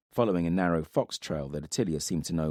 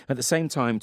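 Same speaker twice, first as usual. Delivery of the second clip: ordinary delivery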